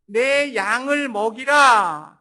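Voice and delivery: very loud, slow, drawn-out proclamation; booming scriptural command; the Lord's command. Acted. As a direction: thunder it out as a solemn commission. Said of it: "이제 주님은".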